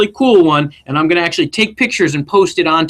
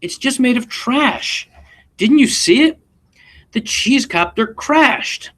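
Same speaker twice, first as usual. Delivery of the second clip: slow, higher, a touch of dismay; mild dejected girl; 8 semitones higher, slower than usual. Acted. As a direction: sigh it out as a let-down child. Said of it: "said dear Rosie".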